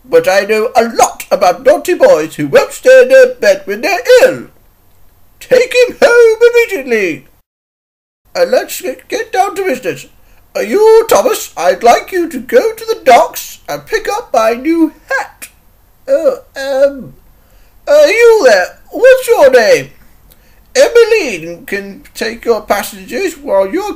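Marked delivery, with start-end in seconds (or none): 0.0-7.3 high, loud telling-off; indignant scolding. Acted. Scolding a sick boy out of bed, indignant. 17.9-19.9 high, loud hail; calling out. Acted. Controller hailing an engine whose name he forgets, calling out.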